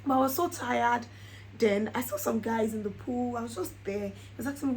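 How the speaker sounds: usual speaking voice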